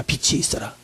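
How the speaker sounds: God's word breathed in a whisper; whispered command. Acted. Preacher quoting God's creation word, whispered. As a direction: whisper 빛이 있어라 as a sacred secret. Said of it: "하나님은 말씀하십니다".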